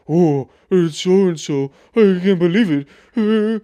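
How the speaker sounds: loud, high mock fan squeal; starstruck fan. Mocking fans who spot a celebrity, loud starstruck squeal. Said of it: "And they just fucking".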